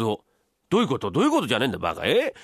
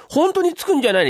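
sharp high, loud shocked question; startled wife; 14 semitones higher, 6 dB louder than usual. Acted. Wife shocked at husband's fake pounding, high cry. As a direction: catch her breath in disbelief.

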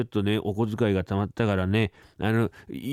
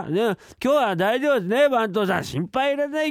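higher, giddy clerk boasting; tipsy clerk swears he'll fudge the books; tipsy head clerk; 10 semitones higher than usual. Acted. Servant reassures the staff, high and giddy.

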